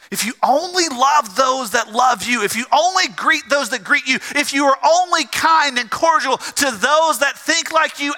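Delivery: pressing rhetorical challenge from the pulpit; impassioned preaching. Real, not acted. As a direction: preach it in insistent, stacked phrases.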